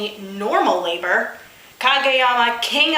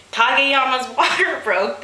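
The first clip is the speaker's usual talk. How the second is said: plain reading voice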